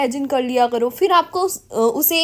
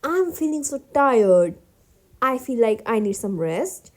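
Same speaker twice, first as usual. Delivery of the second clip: tired; 4 semitones lower, much slower than usual. Shown as a demonstration. Teacher acts tired for students, slow and fading. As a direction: let the tiredness drag the words. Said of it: "थक गए हो".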